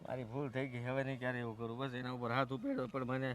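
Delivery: low, soft, sheepish confession; subdued apology. Acted. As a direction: own up meekly to an angry father. Son confesses to angry father. (ધીમા, નીચા અવાજે માફી)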